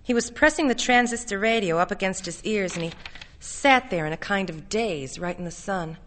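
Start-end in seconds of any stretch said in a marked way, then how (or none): none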